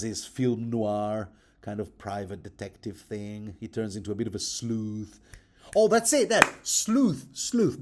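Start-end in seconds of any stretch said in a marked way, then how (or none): 5.7-7.8 sudden high, loud aha; blurting out a title just remembered; excited recognition. Real, not acted. Podcaster recalling a film title, excited high exclamation.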